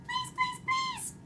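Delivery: very high tiny squeak. A narrator's reading. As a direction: plead in a mouse-sized squeak. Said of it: "I squeaked".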